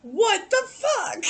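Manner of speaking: high, slow, loud yell; shocked yell. Retold or imitated. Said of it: "You was just like".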